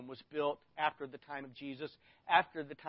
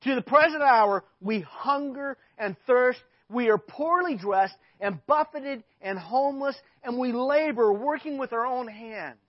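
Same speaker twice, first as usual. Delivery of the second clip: impassioned; 6 semitones higher, 12 dB louder than usual. Acted. Preacher building Paul's rant.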